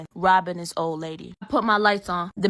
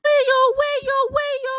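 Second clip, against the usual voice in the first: very high wailing siren noise; imitated police siren; 4 dB louder than usual. Officer turning the car lights on, imitated siren wail.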